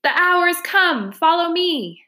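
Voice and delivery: high, dramatic battle call; rallying cry. A narrator's reading. Badger leads comrades into the hall, high rallying cry. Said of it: "and cried".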